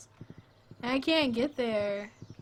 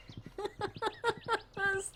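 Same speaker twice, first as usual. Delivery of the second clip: high, rapid giggle fit; real pitched-up giggles; helpless giggling; 10 semitones higher, 5 dB quieter than usual. Real, not acted. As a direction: crack up after protesting. Helpless laughter at own protest.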